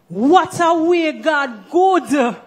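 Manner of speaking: slow, high Jamaican Patois exclamation; Jamaican phrase. Shown as a demonstration. Host teaching overseas guests, in slow Jamaican Patois. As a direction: say it the Jamaican way, drawn out and marvelling. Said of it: "this is what we say".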